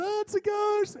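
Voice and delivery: high-pitched panicked yelp, acted; mock freaked-out cry. Disciples in the boat seeing Jesus, freaking out. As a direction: act out a terrified ghost sighting. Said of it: "They're freaking out".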